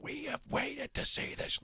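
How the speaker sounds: high, hushed, indignant imitation; strained complaint in a small high voice; mock scolding. Angry audience member scolding Brian, mock indignant. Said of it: "turned around and yelled at Brian".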